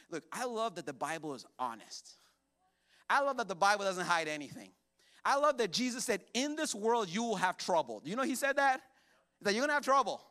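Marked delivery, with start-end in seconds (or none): 3.1-10.2 loud, high, driving sermon delivery; full-force preaching. Acted. Pastor preaching to the church, loud and driving. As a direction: preach it hard to the congregation.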